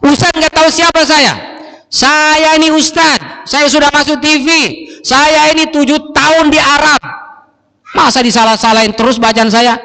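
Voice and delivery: a proud man boasting to the ustadz; mock offended. Offended student snaps at his teacher. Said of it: "cepat tersinggung, cepat marah".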